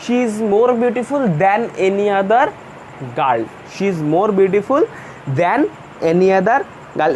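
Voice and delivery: very slow, word by word, with pauses; slow dictation. Shown as a demonstration. Teacher dictating the answer sentence to the class, very slowly. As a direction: dictate it so students can write it down. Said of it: "এইটার comparative কি হলো?".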